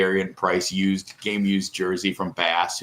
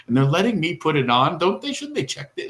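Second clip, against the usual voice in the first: amazed daughter; 8 semitones higher than usual. Retold or imitated. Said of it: "she goes".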